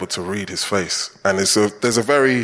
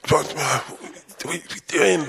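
mock exasperated teammate, higher, flinging it out; 6 semitones higher, 4 dB quieter than usual. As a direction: imitate a teammate glaring after a missed chance. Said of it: "stare at you".

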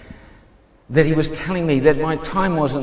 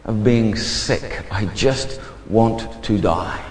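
low, slow, weary confession; understated despair, heavy pauses between phrases; retold despair; 6 semitones lower, slower than usual. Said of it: "it's so bad I would say to my princess".

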